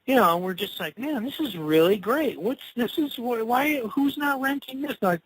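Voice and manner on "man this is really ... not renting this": higher-pitched, wowed house hunter; retold amazement. House hunters marvel at the place, mock amazement. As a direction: act out being blown away by a find.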